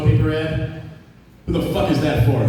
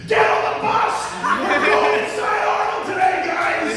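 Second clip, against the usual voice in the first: high-pitched, frantic shriek; manic teacher; 14 semitones higher than usual. Acted. Coked-up teacher herding kids onto the bus, manic screech. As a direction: order the class aboard in a wild screech. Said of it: "ginger from a trailer park".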